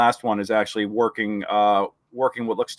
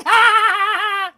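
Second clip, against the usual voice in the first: long, loud, very high creature cackle; Salacious Crumb cackle; 7 dB louder than usual. Acted. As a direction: shriek with mocking imp laughter. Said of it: "It's like Salacious Crumb".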